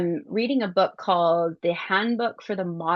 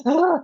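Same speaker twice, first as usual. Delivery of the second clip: loud high exasperated ugh; frustrated groan; 10 semitones higher, 6 dB louder than usual. Retold or imitated. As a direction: groan at the traffic. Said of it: "I kinda went".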